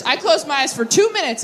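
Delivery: normal talking voice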